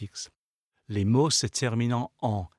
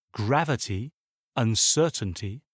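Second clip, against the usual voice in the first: "gravity, uncertainty", each stressed before the last two syllables; antepenultimate-syllable stress. Shown as a demonstration. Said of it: "sont accentués sur l'avant-avant-dernière syllabe".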